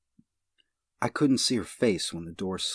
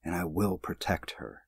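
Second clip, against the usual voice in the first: ordinary delivery, nothing marked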